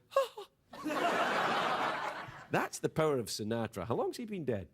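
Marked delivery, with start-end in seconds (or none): 0.1-2.1 breathy excited lady's laugh; excited lady's gasp. Retold or imitated. Host mimics audience lady thrilled by Rat Pack, breathy laugh. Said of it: "She went".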